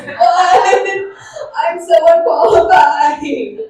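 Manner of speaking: high, loud mock panic, half sung. Comic panic at the keynote invitation, high and loud. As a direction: fake alarm at a serious invite. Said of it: "And I'm like".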